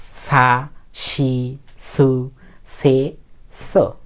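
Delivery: slow, evenly spaced kana syllables; teacher chanting out the s-row; model recitation. Shown as a demonstration. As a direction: hold each of সা, শি, সু, সে, সো long, with a gap between. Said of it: "এভাবে পড়বেন".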